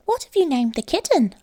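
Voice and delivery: a small house mouse welcoming her friend home; little mouse voice. A narrator's reading. Mouse asks about kitten.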